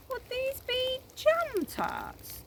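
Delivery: very high, slow, sly crooning; crocodile voice. A narrator's reading. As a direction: draw it out like a scheming crocodile. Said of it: "it said".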